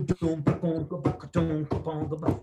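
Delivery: vocal bass line, rhythmic and bouncy; bass riff imitation. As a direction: hum the riff like a bass guitar. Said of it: "and then I do".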